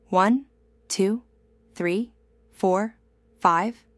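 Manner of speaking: one, two, three, four, five, drawn out for the learner; slow counting. Shown as a demonstration. Teacher counting slowly for learners to repeat. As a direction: say each number slowly and clearly. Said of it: "Repeat after me slowly".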